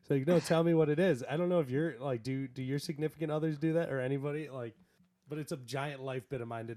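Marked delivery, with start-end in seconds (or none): 0.3-1.2 louder, insistent partner voice; loud demanding. Retold or imitated. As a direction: demand it loudly, half laughing. Girlfriend demanding to know.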